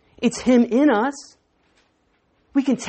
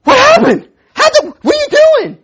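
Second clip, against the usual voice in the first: surprised shout; 12 dB louder than usual. Retold or imitated. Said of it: "guy goes".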